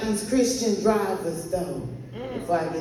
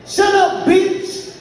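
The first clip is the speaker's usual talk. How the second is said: mock shout; 8 semitones higher, 4 dB louder, much slower than usual.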